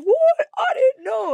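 high-pitched mock-innocent dad; playing dumb. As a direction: squeal innocence, imitating a clueless father. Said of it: "he just acts dumb".

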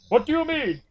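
high-pitched put-on American accent. Retelling Pretzi answering Arnold, imitated American voice. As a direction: exclaim it in a mock American voice. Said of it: "in his American voice".

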